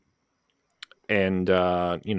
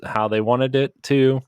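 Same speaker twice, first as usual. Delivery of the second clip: usual speaking voice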